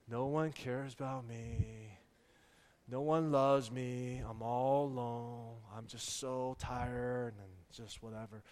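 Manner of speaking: put-on low, slow, quiet mopey child; sulking. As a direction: mumble it in self-pity, dragging every word. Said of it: "he's just sulking".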